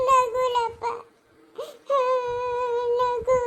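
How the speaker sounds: long-drawn tearful wail; sobbing lament; crying. Acted. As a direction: break into weeping on the words. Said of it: "ನೀನು ಹಿಂಗೆ ಅಳ್ಕೂಡ್ದು".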